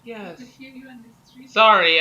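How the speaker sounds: ordinary talk, nothing marked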